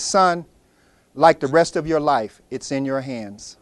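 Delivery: low, soft and slow; wise old man. Acted. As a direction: land the lesson gently. Old man's final wise answer.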